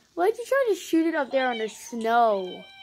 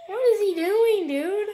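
laughing; 10 semitones higher, 8 dB louder than usual. Real, not acted. Laughing at the clip.

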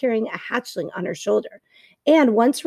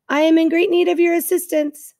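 higher, evenly pitched robot request; friendly robot; 7 semitones higher, 4 dB louder than usual. A narrator's reading. Robot asks old goose for help, friendly robot voice. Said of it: "the robot's friendly voice".

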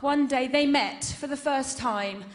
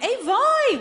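sharp high, loud call of a startled mum; a startled mother blurting out; mum's exclamation; 12 semitones higher, 7 dB louder than usual. Retold or imitated. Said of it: "our mum went".

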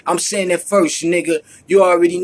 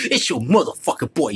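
ordinary delivery

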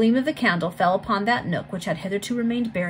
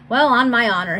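loud, raised, hearty outburst; amused exclamation; 5 semitones higher, 8 dB louder than usual. A narrator's reading. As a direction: exclaim it as if about to laugh. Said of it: "bursting into a loud fit of laughter".

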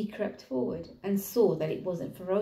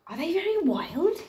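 worried elephant; 10 semitones higher, 3 dB louder than usual. Acted.

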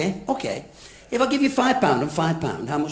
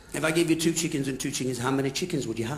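quick teacher; 7 semitones lower, 8 dB quieter, faster than usual. Shown as a demonstration. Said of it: "I'll change it".

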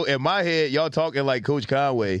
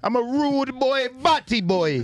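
put-on Jamaican accent; 7 semitones higher, much slower than usual. Joking Jamaican accent bit.

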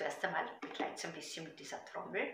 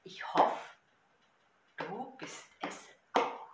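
Gedichtvortrag; 11 dB louder, slower than usual. Shown as a demonstration. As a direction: recite to a drumbeat, easing down to a hushed ending. Recites poem with drum. (rhythmischer Gedichtvortrag, am Ende leise)

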